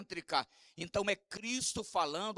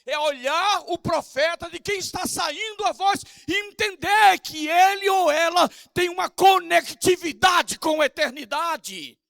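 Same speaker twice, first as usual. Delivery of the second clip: loud, high shouted sermon; full-force preaching; 8 semitones higher, 9 dB louder than usual. Acted. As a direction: shout it from the pulpit. Pastor preaching on anointed voice.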